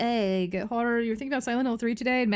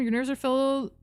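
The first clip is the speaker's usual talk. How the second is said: ordinary delivery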